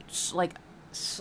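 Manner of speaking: ordinary talk, nothing marked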